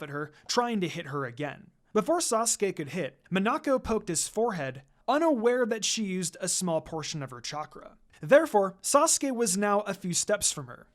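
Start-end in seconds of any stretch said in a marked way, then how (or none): none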